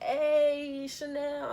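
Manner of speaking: high, loud friendly hey; boys greeting her. Retold or imitated. The boys greeting Chanel at the door, high loud hello. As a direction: call out her name like the guys did.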